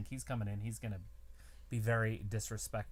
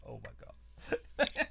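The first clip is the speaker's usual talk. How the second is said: awestruck aside; much slower than usual. Retold or imitated. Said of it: "you're like".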